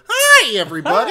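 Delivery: squeaky, over-bright host intro; high-pitched imitation. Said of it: "your voice is even higher".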